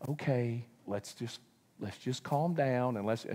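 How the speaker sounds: soft, low soothing; calming parent. Retold or imitated. Parent calming squabbling kids, soft and low. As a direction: settle the kids down gently. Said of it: "Do you just go".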